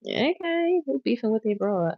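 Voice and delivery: high, incredulous okay; shocked, sassy question; surprised. Real, not acted. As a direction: pitch jumps way up in disbelief. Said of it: "I can't".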